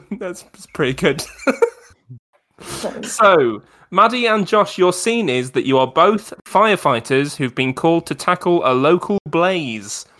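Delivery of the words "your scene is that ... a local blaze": usual speaking voice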